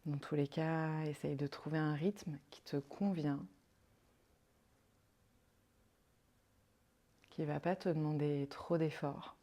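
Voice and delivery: calm, unhurried meditation voice with long pauses; guided meditation. Acted. Yoga guide leading a listener into rest and counted breathing, guided meditation. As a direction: guide them softly into rest, leaving space between phrases.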